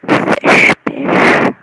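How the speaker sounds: loud, unvoiced-sounding blurt of FISH; silly exaggerated word. Vlogger playing with the word fish, exaggerated. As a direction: blast FISH out with a big hissing sh.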